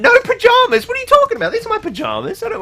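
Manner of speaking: very high, loud squeaky outrage; hammed-up shocked falsetto; mock indignant squeal. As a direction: shriek in pretend offence at the comment. Mock outrage at a viewer's comment.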